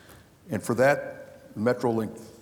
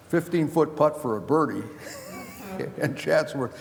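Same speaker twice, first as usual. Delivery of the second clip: chuckling through the words, pitched higher; laughing; 5 semitones higher than usual. Real, not acted. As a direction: laugh while telling it. Laughing at his own story.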